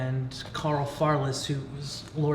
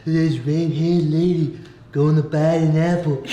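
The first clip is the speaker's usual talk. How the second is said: louder, put-on singer voice; 3 semitones higher, 7 dB louder than usual. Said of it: "fans of [name]".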